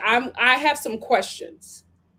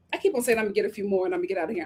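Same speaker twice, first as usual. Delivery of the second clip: usual speaking voice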